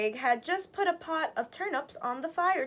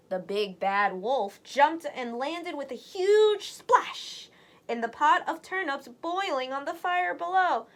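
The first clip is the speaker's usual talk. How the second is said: ordinary delivery, nothing marked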